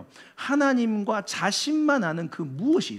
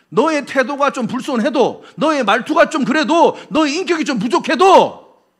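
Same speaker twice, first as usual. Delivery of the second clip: raised, insistent voice, higher and louder; emphatic concession; 7 semitones higher, 6 dB louder than usual. Acted. Preacher granting each flaw of a person, emphatic and raised. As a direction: pile up each point with rising force.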